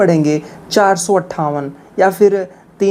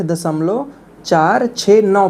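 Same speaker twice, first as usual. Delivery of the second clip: slow, separate "चार", "छः", "नौ" after "दशमलव"; digit by digit; slower than usual. Shown as a demonstration. Teacher modelling a larger decimal, digit by digit. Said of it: "उनको एक one by one पढ़ेंगे".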